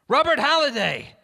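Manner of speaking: loud, high call across a distance; megaphone shout. A narrator's reading. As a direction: hail him as if through a megaphone. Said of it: "Garrison grasped the megaphone".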